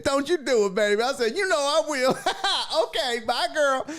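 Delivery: high, excited back-and-forth with laughs; gleeful high-pitched phone goodbye; giggly banter. Retold or imitated. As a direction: squeal it playfully, laughing through.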